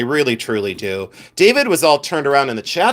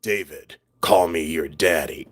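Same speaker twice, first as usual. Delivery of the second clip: very low, hushed dad voice; mock-menacing deep daddy voice; 9 semitones lower, 5 dB quieter than usual. Shown as a demonstration. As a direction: drop deep into the chest, trying not to laugh. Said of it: "I said it in my dad voice".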